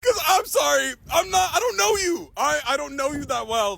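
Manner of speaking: high-pitched, hammed-up disowning; exaggerated protesting apology. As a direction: plead innocence in a mock high voice. Acted disowning of a new friend.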